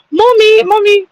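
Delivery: high-pitched imitation of a small English child; little British boy. Imitating a little boy heard in the UK, high child voice with British accent.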